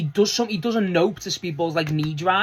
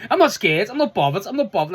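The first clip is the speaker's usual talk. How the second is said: louder, higher taunt that trails down; mock defiant; 5 semitones higher, 4 dB louder than usual. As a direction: puff up and brag you're not scared. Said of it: "that's the type of thing he was doing".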